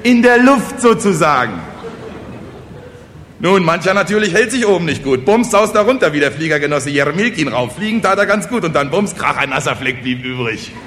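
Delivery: high, loud, excited telling; carried-away village orator. Acted. Kosonossow tells peasants about flying and crashes, carried away. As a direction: get swept up in your own speech.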